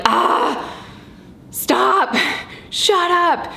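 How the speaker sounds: rising, exasperated mock outburst, pitch climbing up to a high "shut up"; fed-up mom venting at the bickering kids; mock exasperated snap. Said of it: "I really just wanna be like".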